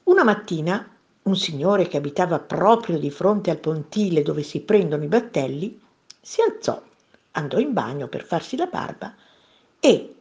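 plain reading voice

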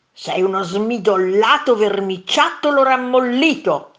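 loud, relished name-calling; angry insult. A narrator's reading. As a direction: fire back each insult forcefully. Second monster shouts insults back. (insulto urlato con rabbia)

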